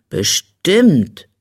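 drawn-out, mildly pondering reply; thoughtful. A narrator's reading. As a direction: weigh it up as you say it. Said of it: "erwiderte Stinker nachdenklich". (gedehnt und nachdenklich)

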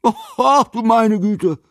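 high, loud cry of dismay; old man's loud, high-pitched astonishment; dismayed exclamation. A narrator's reading. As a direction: exclaim in shock, pitched right up. Old man reacts to three meals.

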